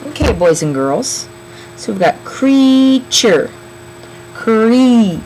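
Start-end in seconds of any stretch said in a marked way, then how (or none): 4.3-5.2 Cree with a long, loud E; long E sound. Shown as a demonstration. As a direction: hold the "ee" in Cree, higher and louder. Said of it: "Do you hear E saying its name".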